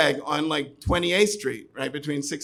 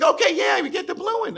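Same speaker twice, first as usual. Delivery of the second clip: high, louder mock-bright voice; Liz's cheeky close; 4 dB louder than usual. Liz overriding the customer, high and chirpy. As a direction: breeze past the answer and close the sale.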